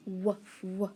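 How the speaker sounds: W as a short wuh, repeated; W, W as two isolated wuh sounds; letter sound. Shown as a demonstration. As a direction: say wuh for W, not its name. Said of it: "what about".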